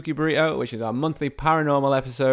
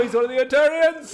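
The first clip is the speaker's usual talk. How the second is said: mock grand announcement; 11 semitones higher than usual.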